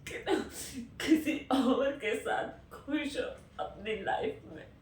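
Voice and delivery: loud, sobbing, words broken by gasps; breaking down crying. Real, not acted. Cannot convince herself the lover is gone, sobbing. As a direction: choke the words out through sobs. Breaks down over the lost love.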